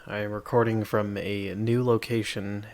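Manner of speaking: ordinary delivery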